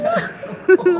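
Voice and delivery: put-on loud, awed kid brother; thrilled little brother; excited kid. Brother turns to the windshield, loud and awed. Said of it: "My brother turns around and goes".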